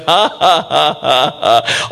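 a lightly voiced grandmother's gloating laugh; mock gloating laugh. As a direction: chuckle like a mother whose prophecy came true. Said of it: "And I know she's thinking".

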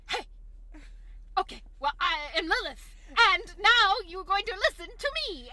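high, loud commanding mock voice; bad Lilith impression. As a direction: boss them around, hammed-up. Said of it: "Bill talks".

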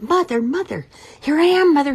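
a chick running to what it thinks is mom; eager baby bird. Acted. Baby bird calls big thing.

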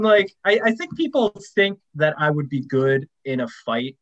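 ordinary delivery

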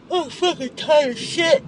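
high, slow cry of real frustration; tearful exasperation. Real, not acted. Upset driver venting that they are worn out, tearful. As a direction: let it break out in a drawn-out wail.

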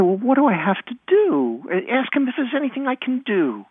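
put-on high, pleading little-kid voice; desperate child. Crushed child self pleads, retold.

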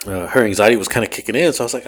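normal talking voice

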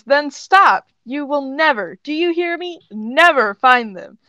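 heated demand; insistent command. A narrator's reading. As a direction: forbid it forcefully, stressing each never. Character forbids another in quarrel.

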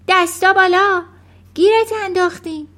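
loud, high commanding cry; shouting. A narrator's reading. Matilda bursting into the room on the burglar, shouting. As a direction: yell it at a cornered intruder. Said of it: "فریاد کشید".

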